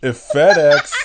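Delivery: loud laughing burst on the words. Real, not acted. Reading a silly scam text, loud and laughing. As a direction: blurt it out through laughter. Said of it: "is an incredible phrase".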